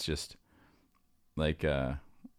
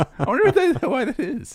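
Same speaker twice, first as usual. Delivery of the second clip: laughing; 7 dB louder than usual. Real, not acted. Laughing at chipmunk voices.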